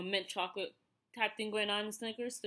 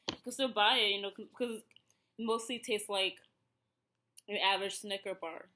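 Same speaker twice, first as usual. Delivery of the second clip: ordinary delivery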